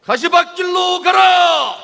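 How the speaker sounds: slow, high, shouted slogan; roaring rallying speech. Real, not acted. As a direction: bellow the chant for the crowd to echo. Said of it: "우리의 구호를 함께하겠습니다".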